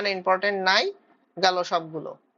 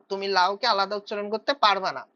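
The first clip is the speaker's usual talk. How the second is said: ordinary delivery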